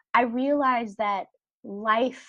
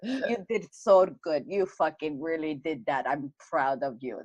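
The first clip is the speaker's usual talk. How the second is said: put-on a light Bulgarian accent, lower, cursing; mild Bulgarian trainer accent, proud; lightly imitating a Bulgarian trainer's accent; 5 semitones lower than usual. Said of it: "he's Bulgarian, so he's like".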